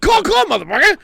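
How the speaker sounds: high, loud squawking parrot. Acted. Host voicing the parrot, high loud squawk. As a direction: screech it like a parrot.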